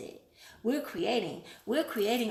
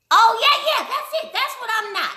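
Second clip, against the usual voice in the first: much higher, louder, excited yeah yeah; excited eureka; 12 semitones higher, 11 dB louder than usual. Real, not acted. Vlogger finding the missing word, excited high outburst. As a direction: blurt it out like you finally found the word.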